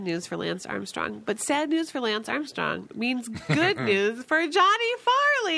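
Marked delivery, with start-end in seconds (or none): 5.0-5.6 excited announce. Acted. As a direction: belt out the name like a jingle. Show name shouted as intro.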